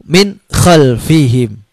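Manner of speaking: plain, slow, clean min khalfihim; correct clear reading. Shown as a demonstration. Teacher giving the correct clear version. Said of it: "Seperti itu".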